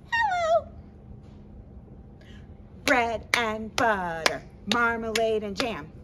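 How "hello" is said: squeaky hello way up high. Shown as a demonstration. Librarian leads the kids' high hello, squeaky high. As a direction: go as high as your voice goes. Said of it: "Let's say hello as high as we can".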